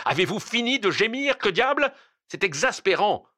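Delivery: high, loud burst of exasperation; enraged shout. A narrator's reading. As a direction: shout down the whining colleague in a rage. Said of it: "Un accès de rage ... en criant". (cri de rage exaspéré)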